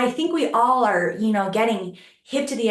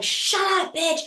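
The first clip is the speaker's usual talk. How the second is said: mock angry retort; 10 semitones higher than usual. Attacker silencing her protests, mock angry and high. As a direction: snap it high as a retold insult.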